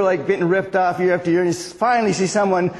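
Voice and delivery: ordinary talk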